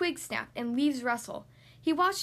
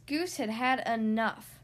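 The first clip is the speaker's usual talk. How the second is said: ordinary delivery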